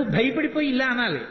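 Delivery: usual speaking voice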